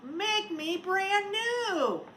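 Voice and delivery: slow, high melting snowman voice; voice from the hot tub. Acted. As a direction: stretch it out like a voice from the water. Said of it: "A voice from the hot tub said".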